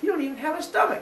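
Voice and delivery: high indignant mother voice; high retort. Retold or imitated. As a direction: snap back in a high voice.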